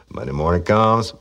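normal talking voice

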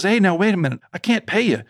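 anxious dad cutting in before the work starts; worried father. Retold or imitated. Father warning the mechanic he can't pay. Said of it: "And my dad says".

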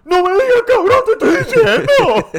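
hammed-up imitation of the offended friend; mocking imitation. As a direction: squeal it like an offended teenager.

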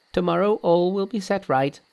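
high-pitched Monkey voice; reassuring Monkey. Acted. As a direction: promise protection in a high character voice. Monkey reassures old priest.

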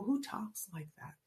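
whispered scoffing question; hushed mocking remark; whispered aside. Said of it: "And I go".

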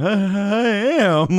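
high, slow and dopey; drugged slow high reply. Retold or imitated. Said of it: "I'm just like".

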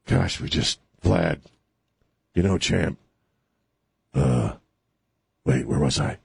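lightly voiced, halting old politician; Joe Biden. Imagining the president talking to Putin, faltering impression. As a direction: lose the thread, pausing between fragments. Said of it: "that's what Biden will bring up to Putin".

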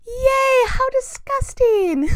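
high mock cheer, then drawn-out disgust; sarcastic disgust. Real, not acted. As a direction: cheer it fake, then slowly say how gross. Mouse found in bottle.

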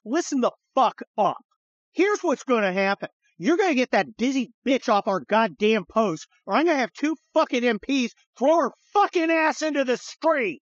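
officer threatening the UN men, forceful anger; angry ultimatum. Retold or imitated. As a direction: lay down the law, fed up. Slamming table, threatening UN.

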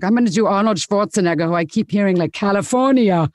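a woman's half-hearted Austrian Arnold; bad Schwarzenegger impression. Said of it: "bad woman Arnold Schwarzenegger impersonation".

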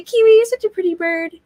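talking to a pet. Retold or imitated. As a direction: coo it like praising a bird. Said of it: "'cause we'd always be".

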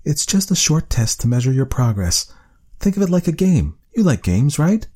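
a hint of a kind doctor talking a child into a test; gently coaxing doctor.

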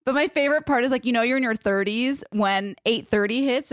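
ordinary talk

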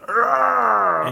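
high strained yell of rage; mock angry roar. Tony chucking his drums into the street, furious roar. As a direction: belt out a furious wordless roar.

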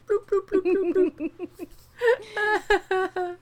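very high little bloops; playful bloop noises. Pretend. Miming little lizard hands held out, in high playful bloops. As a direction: pop out tiny high-pitched bloops.

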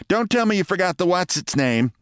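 high-pitched scolding wife; nagging wife. Retold or imitated. Wife scolds her forgetful husband, high and nagging. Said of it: "she would ask".

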